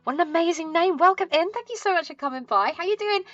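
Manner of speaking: high, bright welcome to raiders; cute anime streamer. Acted. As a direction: squeal hello to the incoming raid. Greeting raiders.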